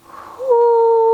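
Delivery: high, sustained hoo; owl hoot. Pretend. Reader turning head as an owl, long high hoot. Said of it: "and say".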